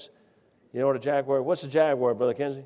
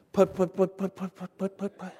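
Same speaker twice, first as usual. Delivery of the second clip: softer putt-putt engine sputter; moped putt-putt; 5 semitones higher, 4 dB quieter than usual. Retold or imitated. Preacher imitating the old man's moped pulling up, fading putt-putts. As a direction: imitate a little moped motor.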